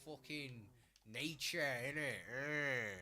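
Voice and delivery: put-on chav voice. Shown as a demonstration. Showing what a chav sounds like, put-on chav voice. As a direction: slur into a chav act, stretching the insults. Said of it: "a chav who talks like this".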